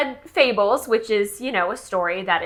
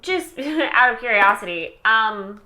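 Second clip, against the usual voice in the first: normal talking voice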